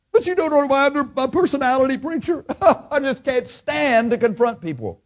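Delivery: high, pleading churchgoer whine with a laugh; whiny excuse. Retold or imitated. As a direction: squirm out of it like a timid member. Church member whining excuse.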